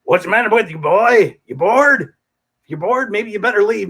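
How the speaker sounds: loud, high, taunting wild man; mock a bored fan from the stage, loud; taunting Mojo. Mojo yelling at a fan from the stage, loud and high. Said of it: "He goes".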